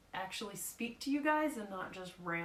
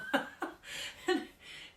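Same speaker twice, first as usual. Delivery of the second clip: breathy laugh; 10 semitones higher than usual. Real, not acted. Laughs at own story.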